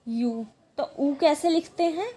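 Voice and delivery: ordinary talk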